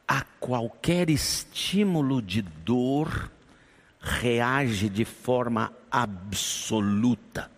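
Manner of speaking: quoting the doctor very slowly, word by word; slow explaining doctor. Retold or imitated. Doctor teaching the grieving pastor, very slow and deliberate. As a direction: spell out the lesson slowly and deliberately.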